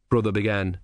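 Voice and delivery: plain reading voice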